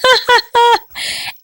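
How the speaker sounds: bright high cookie giggle. Acted. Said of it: "a laughing mouth".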